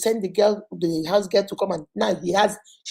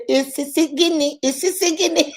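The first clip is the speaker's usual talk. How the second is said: put-on slow, higher, child's "Ese gini" with a laugh; child copying "Ese gini" slowly and higher; daughter Casey's voice; 7 semitones higher, 4 dB louder, slower than usual. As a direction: draw out "Ese gini" like a giggling little girl. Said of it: "Like my daughter Casey will say".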